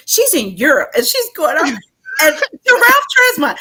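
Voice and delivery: mom's rant boils over; from firm to a loud laughing yell; building to a yell. Retold or imitated.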